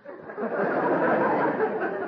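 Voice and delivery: high, breathless tittering chuckle. Real, not acted. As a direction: giggle high at your own joke. Gildersleeve laughs at his own joke.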